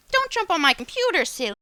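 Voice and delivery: high, loud pet-voice scold; playful scolding of dog. Real, not acted.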